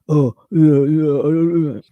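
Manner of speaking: loud, wordless mock dimwit noises; mocking sheeple. Imitating people who won't read, loud and mocking. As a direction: put on a dopey mocking voice, loud. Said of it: "who are just".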